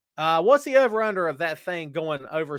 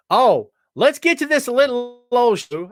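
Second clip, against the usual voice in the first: higher, louder, slow showman opener; hammed-up show intro; 10 semitones higher, 11 dB louder than usual. Host kicking off his wrestling show, big announcer voice. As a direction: roll out the show like a ring announcer. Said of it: "I said that, like, four times today".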